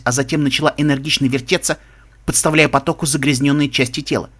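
usual speaking voice

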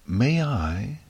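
ordinary delivery

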